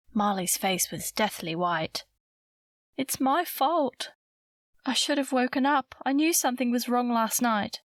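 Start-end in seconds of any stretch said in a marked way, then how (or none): 3.0-7.8 high-pitched, upset confession; distressed self-blame. A narrator's reading. Girl blaming herself for her missing friend, distressed.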